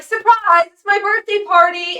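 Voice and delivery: high, loud mock party cheer; spring the surprise, ham it up high and loud; eerie birthday yell; creepy surprise shout. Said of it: "and Jake's like".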